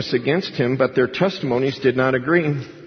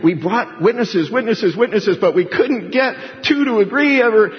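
mock exasperated priests; 9 semitones higher, 4 dB louder than usual. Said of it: "So every time they saying".

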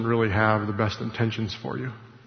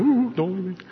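mock-bashful squeal, much higher; a coy little shocked voice, much higher; playing a prudish animal, high and squeaky; 7 semitones higher than usual. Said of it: "the animals kinda going".

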